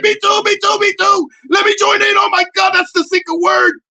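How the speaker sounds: high, loud, begging to join; eager mock yell. Comic begging to join the couple, shouted high. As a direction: shout it like a giddy kid. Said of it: "I was like".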